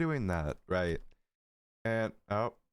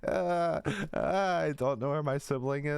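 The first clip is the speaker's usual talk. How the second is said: put-on higher, slow, teary kid voice pleading; mock sobbing child; 7 semitones higher than usual. Lost little boy tells the helper he can't find his sibling, mock sobbing. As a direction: play a lost six-year-old half-crying. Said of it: "I'm sobbing".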